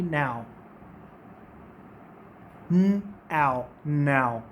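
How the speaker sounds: now and आओ low, gliding ah to oo; teacher modelling "now"; diphthong demo. Shown as a demonstration. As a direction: slide now from ah into oo. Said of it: "ये pronunciation क्या बनेगी".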